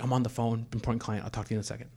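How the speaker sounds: half-whispered brush-off; retold hushed reply. He puts off his partner during a client call, retold hushed reply. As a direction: keep it under your breath, as if mid phone call.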